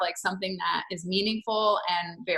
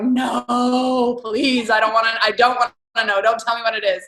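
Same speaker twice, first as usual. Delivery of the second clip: a touch of mock pleading; retold dread, a little louder; 5 dB louder than usual. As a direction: beg him not to say it, lightly acted. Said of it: "was like".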